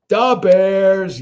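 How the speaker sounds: Da Bears, loud and high, heavy Chicago accent; Chicago sports fan cheer. Shown as a demonstration. Streamer shouting Da Bears to chat, loud Chicago accent. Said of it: "Da Bears".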